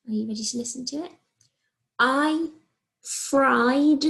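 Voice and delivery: ordinary talk, nothing marked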